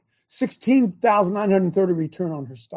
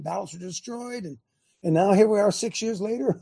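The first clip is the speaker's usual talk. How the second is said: ordinary talk, nothing marked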